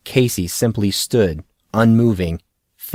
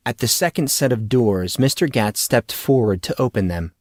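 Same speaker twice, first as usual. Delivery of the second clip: ordinary delivery, nothing marked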